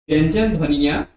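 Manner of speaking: ordinary talk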